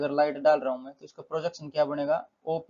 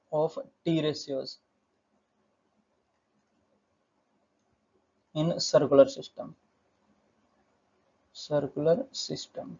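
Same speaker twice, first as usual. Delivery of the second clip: slow, spaced-out phrases with long pauses; slow repeating as the pen catches up; dictating while writing; much slower than usual. Real, not acted. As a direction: dictate it piece by piece as you write. Saying words while writing them out.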